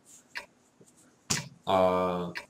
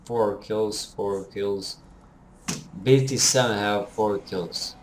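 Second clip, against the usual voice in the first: ordinary delivery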